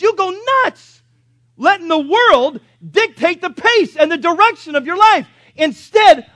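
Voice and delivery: loud, high, emphatic preacher delivery; full-force preaching. Real, not acted. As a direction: drive each point home to the congregation, loudly.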